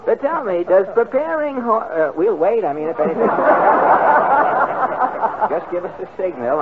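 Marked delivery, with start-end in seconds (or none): 3.3-5.4 high ringing laugh; loud laugh. Real, not acted. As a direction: laugh it off at the interruption. Host laughs at interruption.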